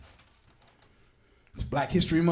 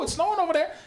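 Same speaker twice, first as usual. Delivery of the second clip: ordinary delivery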